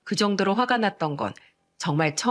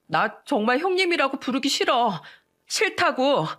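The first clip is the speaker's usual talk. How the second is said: higher-pitched petulant protest; stubborn refusal; 6 semitones higher than usual. A narrator's reading. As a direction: refuse like a sulking wife, pitch up. Wife refuses in acted quarrel.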